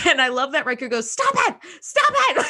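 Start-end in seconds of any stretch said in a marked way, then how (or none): 1.1-2.4 high, laughing mock scream at the enemy ship. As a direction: shriek it like a captain losing it. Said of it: "He just starts screaming".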